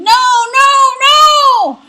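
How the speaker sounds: terrified high shrieking scream; troll screaming as the horns hit. Acted. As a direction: shriek in panic as the goat charges. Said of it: "the troll screamed".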